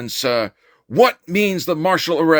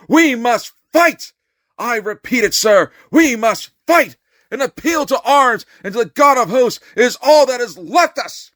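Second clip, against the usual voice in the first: rousing shout; 7 semitones higher, 3 dB louder than usual. Acted. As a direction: call them to arms at full force. Climax of a rousing speech.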